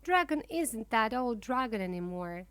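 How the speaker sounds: usual speaking voice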